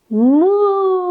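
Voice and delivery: high, loud drawn-out moo; cow moos. Shown as a demonstration. Teacher leads children in cow pose, mooing. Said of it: "One more time".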